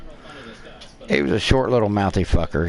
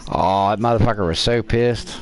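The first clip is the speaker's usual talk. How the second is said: normal talking voice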